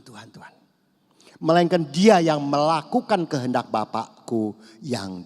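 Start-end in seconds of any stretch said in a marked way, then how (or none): 0.0-0.5 whispered, fading; whispered mock plea. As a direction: barely breathe the name Tuhan. Mimics empty calling on God. (berbisik memohon pura-pura)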